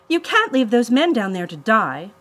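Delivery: loud, high-pitched confrontation; indignant protest. A narrator's reading. Claire turns on captor to save stranded men, indignant. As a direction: challenge the villain forcefully.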